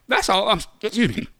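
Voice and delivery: winded after a rant; catching breath mid-sentence. Real, not acted. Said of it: "lost my breath".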